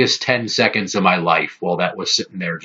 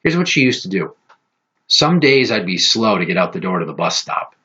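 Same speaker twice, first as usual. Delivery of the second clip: ordinary delivery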